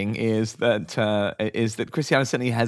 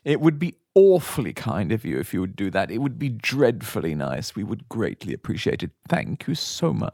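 pleading personal appeal; 4 semitones lower than usual. Acted. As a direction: plead gently with excessive English courtesy. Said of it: "a personal appeal from [name]".

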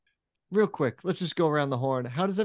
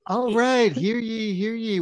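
loud, high proclamation; town crier; 7 semitones higher, 5 dB louder than usual. Acted. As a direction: call the court to order like a herald. Judge opens mock court.

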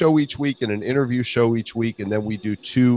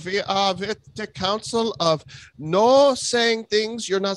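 menacing censor; 9 semitones higher than usual. Pretend. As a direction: threaten like a secret council.

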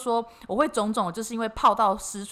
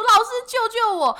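high, loud frightened cry for help; panicked plea; 12 semitones higher, 6 dB louder than usual. Retold or imitated. Scared client pleading with the fortune teller, high frightened cry. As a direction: beg the teacher in sudden terror.

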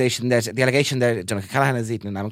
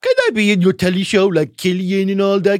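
higher, louder, hammed-up begging; eager showing off; 6 semitones higher, 6 dB louder than usual. Wannabe asking Oliver Callan for telly work, hammed-up begging. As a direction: play a pushy wannabe asking for a job. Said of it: "am I subconsciously showing Oliver Callan".

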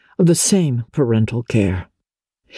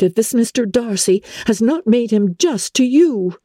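put-on higher young woman's voice, indignant and shocked; Elizabeth, indignant; 8 semitones higher than usual. A narrator's reading. Elizabeth to Wickham, outraged at Darcy's pride, higher indignant voice. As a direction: play a young lady outraged on his behalf.

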